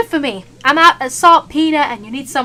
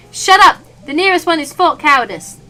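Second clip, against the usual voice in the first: angry shout; 5 semitones higher, 8 dB louder than usual. Acted. Chef snaps.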